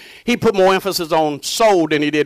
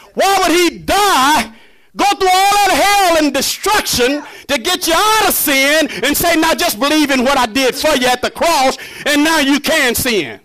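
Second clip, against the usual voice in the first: full-force preaching; 7 semitones higher than usual. Real, not acted. Preacher proclaiming salvation to the congregation, high-pitched preaching. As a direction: preach it high with long held endings.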